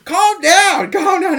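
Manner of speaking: hammed-up frightened wife begging her husband; mock panicky wife. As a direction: plead in a high panicky voice. Wife voiced panicking in retold movie.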